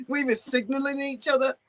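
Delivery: high laugh into the words. Real, not acted. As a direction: giggle through the line. Laughing at the absurd claim.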